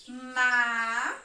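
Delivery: "Ma" drawn out as one syllable; isolated, stretched "Ma"; syllable marking. Shown as a demonstration. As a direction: hold "Ma" on its own for counting. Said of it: "eu vou marcar a sílaba".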